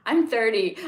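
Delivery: sudden loud exclamation of own age; shocked. Real, not acted. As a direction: blurt it out in disbelief.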